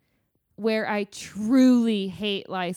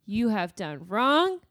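put-on stern parent telling off a child, building louder; mock scolding; 6 dB louder than usual. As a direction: scold like a cross parent, playfully. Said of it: "he's gonna be like".

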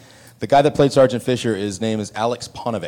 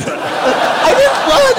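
much higher, near-crying cry of disbelief; flustered denial; 7 dB louder, much slower than usual. Retold or imitated. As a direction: stammer out a panicked denial.

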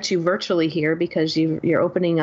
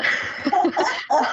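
high giggle; 12 semitones higher than usual. Real, not acted. Laughs, blown away by guest.

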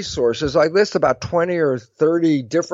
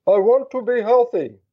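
higher, mimicked playback of a recorded wish; recorder playback; 6 semitones higher than usual. Recorder played a year later, higher mimicked playback voice. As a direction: repeat it like a recording played back. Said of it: "Push the play button here".

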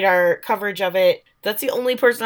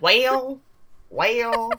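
"well" drawn into a Midwest "way-el"; Midwest accent; 3 semitones higher than usual. Shown as a demonstration. Host shows how own accent bends "well", Midwest accent. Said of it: "You just literally said a Midwest accent".

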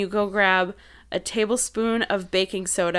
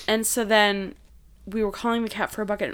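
ordinary talk

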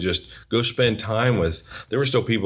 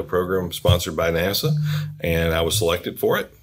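ordinary talk, nothing marked

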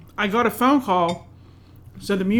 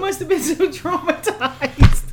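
put-on higher cooing over a poor baby; doting baby talk; 10 semitones higher than usual. Wife mocks herself cooing over the cat, higher and laughing. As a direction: coo to the cat, laughing through it. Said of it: "I was like".

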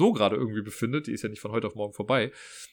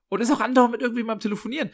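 excited rush; 10 semitones higher, 3 dB louder, faster than usual. Real, not acted. Describing the chatty installer.

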